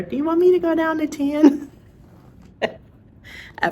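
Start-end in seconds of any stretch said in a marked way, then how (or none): 0.0-1.7 high, sing-out query; eager question. Acted. Asking her doctor about dosage, high-pitched eager question. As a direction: pitch it up as a pestering patient. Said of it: "asking her".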